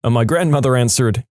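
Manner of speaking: ordinary delivery, nothing marked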